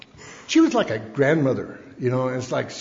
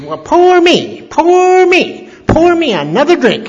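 much higher, slow, squeaky little-man voice; imitating shrill counselor; 12 semitones higher, 9 dB louder, slower than usual. Little counselor mocking two men about their divorce, in a high squeaky voice. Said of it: "had this high little shrill voice".

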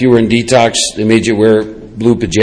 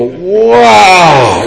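higher, long sliding wow; stoner-like amazement; 5 semitones higher than usual. Retold or imitated. Newly sober man biting into a sandwich, awestruck. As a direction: savour it in dazed wonder. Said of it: "were pure [name] and [name] stuff".

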